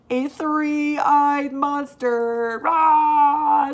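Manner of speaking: slow, loud monster roar; loud monster reveal; scary monster. Acted. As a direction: loom and roar. Monster appears in story.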